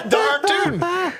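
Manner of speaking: high, slow hammed-up Texan drawl; mock cowboy drawl. Laughing friend mimics a Texan monk in a mock cowboy drawl. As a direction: stretch it out like a cartoon cowboy. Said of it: "he didn't say it that way".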